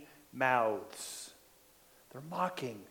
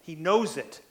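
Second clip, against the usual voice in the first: ordinary talk, nothing marked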